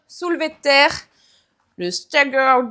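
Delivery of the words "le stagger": playfully put-on English accent; super English accent. Shown as a demonstration. Fitness coach naming an exercise, joking English accent. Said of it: "avec mon super accent anglais".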